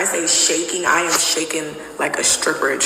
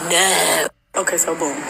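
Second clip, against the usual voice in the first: normal talking voice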